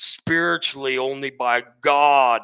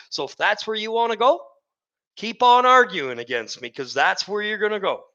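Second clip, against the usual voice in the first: heated warning; 7 semitones higher than usual. Real, not acted. Warning listeners who argue.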